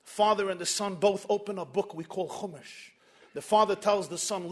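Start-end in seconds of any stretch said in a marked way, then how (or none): none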